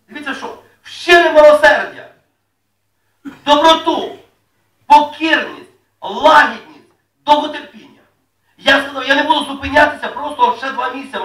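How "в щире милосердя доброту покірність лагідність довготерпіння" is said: slow, loud solemn reading of scripture; preacher reading the Bible to the congregation, slow and loud. Acted. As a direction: proclaim each word to the church. Reads scripture list.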